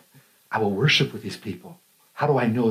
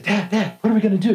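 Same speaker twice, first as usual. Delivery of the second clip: put-on a eleven-year-old pestering his dad; excited kid; 8 semitones higher than usual. Eleven-year-old asking his dad, high and excited. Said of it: "I'm all excited".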